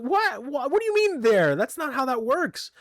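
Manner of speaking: high, startled retelling of her protest; shocked disbelief. Retold or imitated. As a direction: squeak it out in sudden disbelief. She protests his trick.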